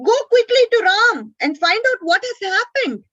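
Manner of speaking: high, loud frantic plea; panicked urging. A narrator's reading. Sita in panic urging Lakshmana to go. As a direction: beg him to hurry, full of fear.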